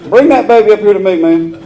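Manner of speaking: high, loud, half laughing mock rant; laughing mock complainer. Irritated man griping about the baby, high and laughing. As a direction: yell it high while cracking up.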